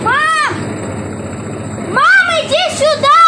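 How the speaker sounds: high, loud call for mom; yelling across the room. Real, not acted. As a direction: shout for mom to come. Calling mother from another room.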